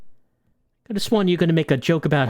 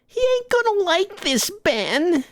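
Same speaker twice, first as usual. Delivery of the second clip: put-on much higher, jittery kid voice; nervous kid snitch; slower than usual. Kid warning Ben, high and nervous.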